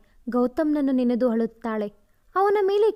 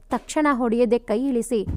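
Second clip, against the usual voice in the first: plain reading voice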